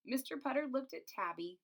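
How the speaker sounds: ordinary delivery, nothing marked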